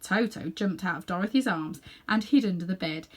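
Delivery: plain reading voice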